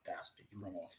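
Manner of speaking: unvoiced whispered curse. Real, not acted. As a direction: breathe it out under your breath. Whispered insult.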